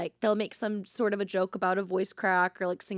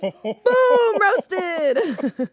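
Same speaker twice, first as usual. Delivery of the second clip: triumphant shout; 9 semitones higher, 10 dB louder than usual. Real, not acted. Landing a roast joke on Heath.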